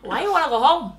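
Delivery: South African attempt sliding into Australian; accidental Australian accent. Imitating colleague for the host, accidental Australian accent. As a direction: try South African, land on Australian, giggling. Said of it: "That's Australian".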